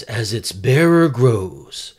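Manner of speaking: loud, weighty storybook narration; dramatic narrator. Acted. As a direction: announce it like a grand anime narrator. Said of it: "so the narrator says".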